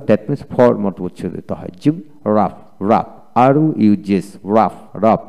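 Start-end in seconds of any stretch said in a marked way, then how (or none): 2.3-3.1 "রাফ" ending in an F sound. Shown as a demonstration. Teacher shows when GH sounds like F, F ending on "রাফ". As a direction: slowly say "রাফ" as raf, closing on F. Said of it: "এফ এর মতো উচ্চারিত হয়".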